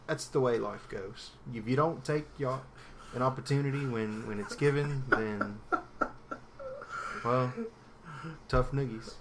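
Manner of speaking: halting put-on British accent, long pauses; dodgy British accent. Podcaster shrugging off a lost chance, put-on British accent. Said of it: "this is my British accent".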